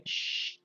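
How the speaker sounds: "shh" as a voiceless hiss from the front of the mouth; "shh" with teeth close and no voice; sh sound. Shown as a demonstration. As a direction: hold a long unvoiced "shh", no throat vibration. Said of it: "La S H".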